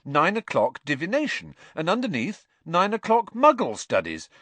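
high, loud, amused disbelief; can't believe what he reads; incredulous. A narrator's reading. Ron to Hermione about her timetable, amused disbelief.

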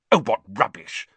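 dismissive, exasperated retort; impatient. A narrator's reading. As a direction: brush it off impatiently. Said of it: "said Hermione impatiently".

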